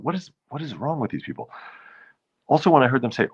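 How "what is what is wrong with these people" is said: incredulous. Retold or imitated. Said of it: "I thought".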